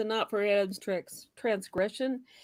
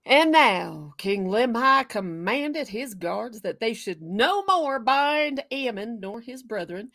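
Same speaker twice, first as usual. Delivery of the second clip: put-on Southern accent. Co-host resuming the scripture reading for the panel, Southern accent. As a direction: deliver the verses with Southern flourish. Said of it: "I do this Southern thing".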